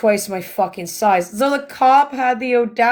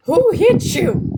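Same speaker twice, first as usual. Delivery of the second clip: alarmed husband; 10 semitones higher than usual. Retold or imitated. Husband's alarmed question on phone.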